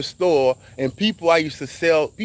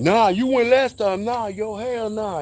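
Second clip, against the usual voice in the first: high, slow mock bickering; mock arguing; 8 semitones higher, slower than usual. Helpers arguing over who drives, mock quarrel. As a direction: act out the two helpers squabbling. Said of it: "And they arguing".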